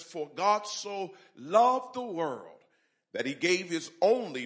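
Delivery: slow, loud, solemn reading of John 3:16; scripture reading. Acted. Pastor reading scripture to the church, slow and loud. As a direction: proclaim the verse, stretching the words. Scripture read aloud with force.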